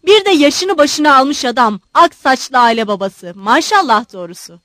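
loud, heated tirade; angry retort. Acted. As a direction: fling reproaches back at full voice. Son rages back at his father.

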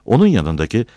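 plain reading voice

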